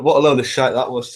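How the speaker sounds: ordinary delivery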